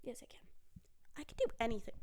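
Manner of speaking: quiet self-talk pep talk; muttering to herself. Real, not acted. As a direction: talk yourself down, then up, softly. Muttered self-talk after a mistake.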